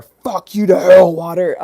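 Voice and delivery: loud mock curse; a hammed-up furious yell; cursing at water. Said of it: "someone looking at water and saying".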